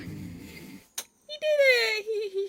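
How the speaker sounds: high, loud, slow delighted cheer; excited. Real, not acted. Cheering the bug's success.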